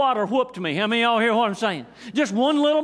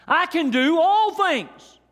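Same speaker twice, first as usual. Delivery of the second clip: loud, slow, high proclamation; preaching the verse at full voice; exclaimed verse; 7 semitones higher, 4 dB louder, slower than usual. Acted. Exclaimed verse after wow.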